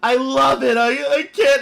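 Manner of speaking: mock him choking up with awe; a hammed-up overwhelmed fan. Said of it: "he starts literally bawling".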